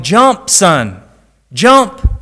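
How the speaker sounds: father yelling up through the smoke. Acted. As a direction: yell it up at the boy, urgent. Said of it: "his father kept yelling".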